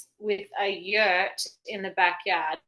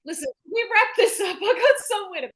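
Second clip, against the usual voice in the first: hammed-up breezy caller, higher and fast; mock Bane; 5 semitones higher, 3 dB louder, faster than usual. Mocking the caller brushing off the operator, breezy and fast. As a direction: brush off the operator like you've somewhere to be. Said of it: "It was literally Bane".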